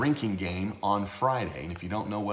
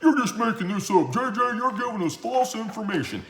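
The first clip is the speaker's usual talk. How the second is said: loud accusing voice, climbing high; hammed-up whiny accuser, getting higher; mock complaining commenter; 8 semitones higher, 7 dB louder than usual. Host imitates viewers accusing him of false information, loud mock complaint. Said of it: "what I got more and more is like".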